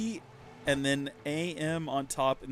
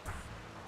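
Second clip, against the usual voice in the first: wistful sigh; 13 dB quieter than usual. Real, not acted. Sigh after remembering youth.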